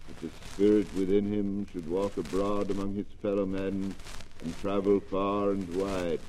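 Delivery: soft, mournful ghost of a dead partner; hollow spirit voice, heavy and weary; ghostly voice. Acted.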